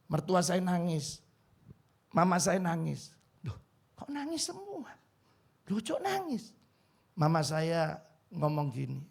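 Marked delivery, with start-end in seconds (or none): 3.4-6.5 surprised. Retold or imitated. Himself at his wedding, everyone crying.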